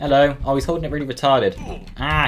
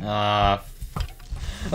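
ordinary delivery

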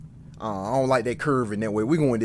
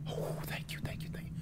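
whispered, breathless thanks; relieved whisper; 13 dB quieter than usual. Real, not acted. Gamer thanking heaven after escaping danger, whispered relief.

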